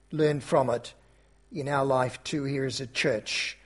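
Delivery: soft, reverent pastoral prayer; closing prayer. Acted. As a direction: pray gently on behalf of the church.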